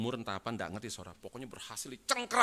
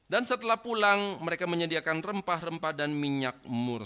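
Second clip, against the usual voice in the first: high, loud declamatory reading; ringing, forceful Bible reading; scripture proclamation; 5 semitones higher, 8 dB louder than usual. Acted. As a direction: proclaim the verse to a whole congregation. Reads scripture aloud to congregation.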